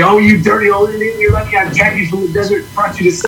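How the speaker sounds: scolding grandma. Retold or imitated. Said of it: "She would say".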